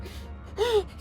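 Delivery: crying gasp. Acted. Gasping as they fight.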